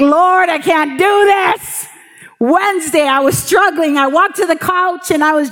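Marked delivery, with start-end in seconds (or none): 0.0-1.9 pleading prayer. Retold or imitated. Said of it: "I was like".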